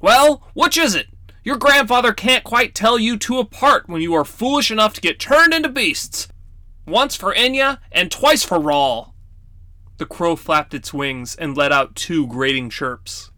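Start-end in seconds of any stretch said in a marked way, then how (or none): 0.0-9.1 put-on high, scolding old man voice; teasing grandfatherly reproach; stern grandfather. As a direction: chide the crow-boy like a fussy old grandpa.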